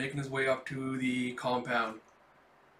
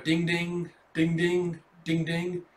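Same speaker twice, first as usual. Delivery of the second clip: imitated door chime; 3 semitones higher, slower than usual.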